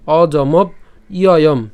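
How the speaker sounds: slow, loud অজমব, pause, ইয়য়ম; broken-up pronunciation. Shown as a demonstration. Teacher breaks the word up for students, slow অজমব ইয়য়ম. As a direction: split অজমব from ইয়য়ম and draw both out. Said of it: "ভেঙে উচ্চারণ করতে পারি".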